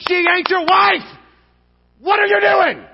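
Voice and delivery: high, full-force yell; outraged scolding. Acted. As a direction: shout it in disbelieving reproach. Acts out scolding a straying friend.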